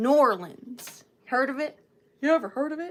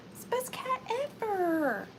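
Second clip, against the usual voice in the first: gushing; 8 semitones higher, 3 dB quieter than usual. Acted. Repeats praise of the cat.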